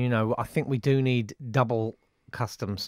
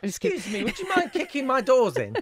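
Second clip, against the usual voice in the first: polite stranger voice; 11 semitones higher than usual. Pretend. Mock polite request to passers-by.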